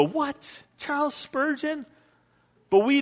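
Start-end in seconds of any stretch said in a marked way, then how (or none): 0.0-1.9 much higher, softer, odd voice of disbelief; good Baptists gasp at Spurgeon's cigars, high mock shock. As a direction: gasp it like scandalized churchgoers. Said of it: "we as good Baptists would go".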